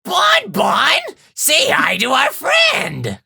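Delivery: high shouty cartoonish showman; Funtime Freddy. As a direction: bellow it like a creepy host. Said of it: "Fun Time Freddy".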